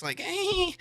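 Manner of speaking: high laughing eh; laughing eh mocking the cherry-faced teens; mocking noise. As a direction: squeak it out in mockery.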